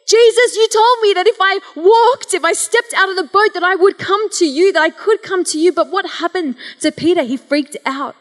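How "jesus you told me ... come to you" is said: loud, high, desperate plea to Jesus; panicked cry. Retold or imitated. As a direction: shout it as if you're sinking. Peter panics while sinking.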